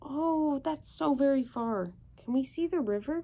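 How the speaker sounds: plain reading voice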